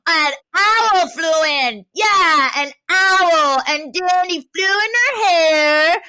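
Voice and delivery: put-on high, loud childish telling of a tall tale; little kid storytelling. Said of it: "a little kid would come up and be like".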